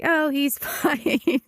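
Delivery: high, drawn-out "he's fine" with a laugh; laughing dismissal. Retold or imitated. Vet joking about neglecting her own dog, laughing dismissal.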